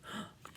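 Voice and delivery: sharp pretend gasp of awe; mock gasp. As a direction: gasp for the kids at the big shark.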